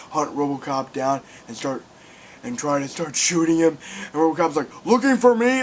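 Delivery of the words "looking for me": higher, louder cocky challenge; mock tough-guy taunt; badass taunt. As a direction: toss it out like a cool action hero. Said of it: "like a badass".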